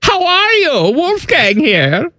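high, mock German character voice; German accent. Host introducing himself as Wolfgang, in a German accent. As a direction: put on a high-pitched German accent, stretching the name. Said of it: "This is my German accent".

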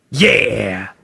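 low, drawn-out one-word approval. Retold or imitated. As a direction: imitate a rock star curtly approving a joke. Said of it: "if he likes a joke, he'll say".

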